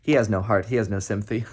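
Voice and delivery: ordinary delivery, nothing marked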